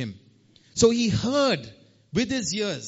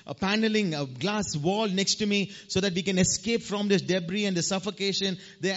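ordinary delivery

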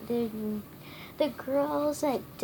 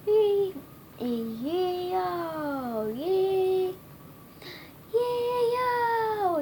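yelling; 8 semitones higher, much slower than usual. A narrator's reading. Funny little man yelps as the boys grab him, yelling. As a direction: stretch the cry out high. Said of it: "yelled the funny little man".

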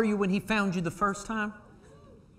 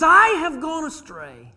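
psalmist's plea; 11 semitones higher, 10 dB louder than usual. Retold or imitated. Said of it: "He says".

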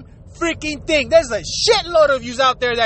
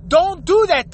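ordinary talk, nothing marked

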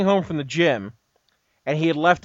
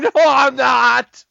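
high, loud, slow wail of a crying caller; hammed-up crying protest; mock wailing sob; 8 semitones higher, 7 dB louder, much slower than usual. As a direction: drag it out like a blubbering caller. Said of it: "He starts crying".

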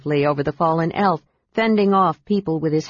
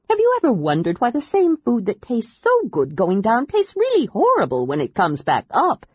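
high, quick babbling voice; a nauseous little creature rambling on; chatty Kender; 5 semitones higher than usual. A narrator's reading.

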